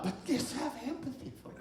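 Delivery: high, soft mock-pleading voice; pleading wife. Wife asks husband for the garage, in a high soft plea.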